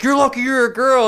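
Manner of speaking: high, loud mock cry-yell; whine-shout a weak threat; man crying and yelling at aunt, mocked. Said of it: "he was like".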